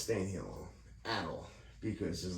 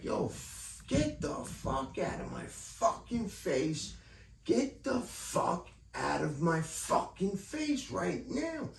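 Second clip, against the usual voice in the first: retold angry yell; 8 semitones higher, slower than usual. Retold telling off supervisor.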